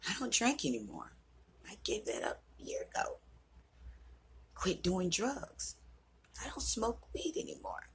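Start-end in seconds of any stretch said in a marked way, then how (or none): 1.7-3.2 whispered aside. Real, not acted. Proud quiet aside. 6.4-7.9 soft whisper, high and breathless; whispered aside. Real, not acted. To viewers, proud of quitting: whispered confession.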